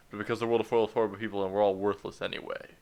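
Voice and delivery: rushed fast read of the line; speed reading. Shown as a demonstration. Player worried about a timer, speed reading the line. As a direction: race through it like it's timed. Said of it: "so I speed read it".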